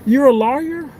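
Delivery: gasp, then a high-pitched startled question; surprised. Real, not acted. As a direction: react as if caught off guard by the news. Reacts to viewer being a lawyer.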